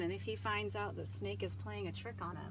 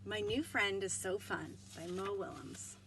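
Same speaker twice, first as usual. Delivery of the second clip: ordinary delivery, nothing marked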